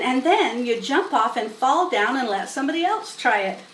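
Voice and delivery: plain reading voice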